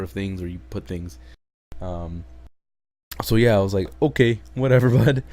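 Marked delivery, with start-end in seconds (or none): 4.0-5.3 loud laughing okay whatever. Real, not acted. Vlogger laughing at the silly plot, loud.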